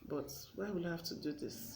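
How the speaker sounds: soft, subdued self-questioning; quiet regret. Acted. Wife questioning herself after the quarrel, quietly regretful. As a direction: ask yourself softly, with regret.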